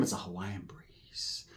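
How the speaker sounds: slow, airy whisper; breezy whisper. Retold or imitated. Describing moving with Islanders, slow breezy whisper. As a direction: whisper it like a soft drifting breeze.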